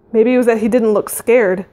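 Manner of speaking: ordinary delivery, nothing marked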